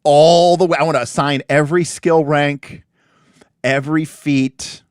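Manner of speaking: loud and emphatic. Real, not acted. Emphatic about building villains.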